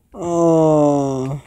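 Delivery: long loud startled oh; loud shout. Real, not acted. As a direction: belt out a shocked oh. Startled in game.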